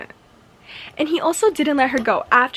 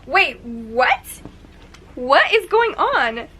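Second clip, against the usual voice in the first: shocked disbelief; 3 semitones higher, 6 dB louder, much slower than usual. Real, not acted. Reader reacting aloud to the stranger brother, shocked. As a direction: cry out slowly at a twist you can't believe.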